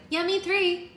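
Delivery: very high, chirpy character voice; high-pitched keen chime-in; eager agreement. Acted. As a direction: pipe up to join in. Friend eagerly joins the pact.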